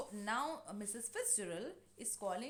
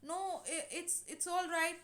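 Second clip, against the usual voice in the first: higher, hesitant, stumbling reassurance; acted nervous stammer, pitched up; 6 semitones higher than usual. Nervous neighbor trying to calm things, nervously. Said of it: "she is getting nervous".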